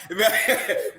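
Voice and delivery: loud, high burst of laughter. Real, not acted. Laughing at the gun joke.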